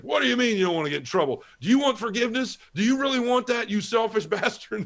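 high-pitched, livid priest tirade. Retold or imitated. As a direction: imitate an outraged priest laying into a penitent. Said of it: "that guy got livid".

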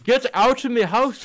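high mock yell; mock shout. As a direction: playfully yell it out.

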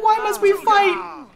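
high, slow theatrical lament; dramatic lament. Pretend. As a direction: ham up a tragic heroine's plea. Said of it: "She's like".

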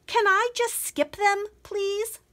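pleading. Acted. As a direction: ask hopefully to be let off. Princess begs to skip peas.

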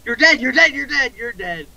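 high, urgent warning calls; alarm shout. Real, not acted. Alarmed warning during fight.

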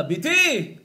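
high, loud acted holler; shouting call. Man pretends to hail his daughter, shouting. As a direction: call out to her across the street. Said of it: "ההוא צועק לה".

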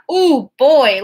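loud country holler. A narrator's reading. New kid hollering at the squirrel, loud. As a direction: call it out across the street. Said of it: "hollered out".

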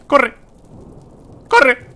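high loud panicked yell; panicked shout. Real, not acted. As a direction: yell at yourself to run. Fleeing the witch.

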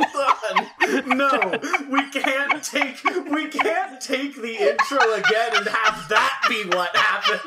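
talking through laughter; cracking up mid-sentence; laughing. Real, not acted. Cracking up over the intro.

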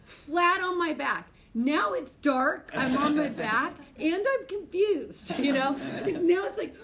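teacher telling students about lying on the floor after the crash, slow and loud; dramatic retelling. Real, not acted. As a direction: stretch out each beat for effect.

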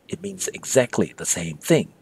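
ordinary talk

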